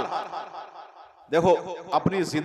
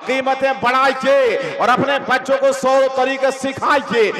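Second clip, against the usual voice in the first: preaching; 6 semitones higher than usual. Acted.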